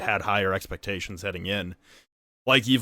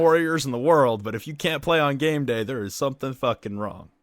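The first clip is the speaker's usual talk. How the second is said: raised, higher, exasperated voice; fired-up, forceful telling-off; heated rant; 6 semitones higher, 4 dB louder than usual. Real, not acted. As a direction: lay into them for their excuses. Heated rant about teams' excuses.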